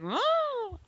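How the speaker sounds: high, drawn-out coyote howl; imitated animal howl. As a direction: imitate a coyote howling at evening. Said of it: "that sound that was like".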